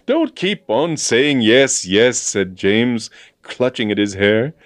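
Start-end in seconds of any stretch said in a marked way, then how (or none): 0.1-2.2 loud, high, emphatic complaint; exasperated. A narrator's reading. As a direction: snap in frustration at the repetition. Exasperated James clutching his hair.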